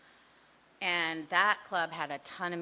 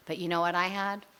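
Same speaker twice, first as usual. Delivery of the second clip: usual speaking voice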